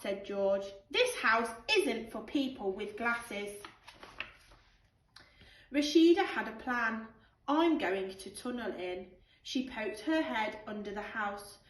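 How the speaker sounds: put-on lively storybook read-aloud for children; animated picture-book narration with character voices; bedtime story reading. Teacher reads bedtime story aloud.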